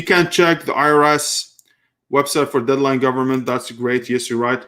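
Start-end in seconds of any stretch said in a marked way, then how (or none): none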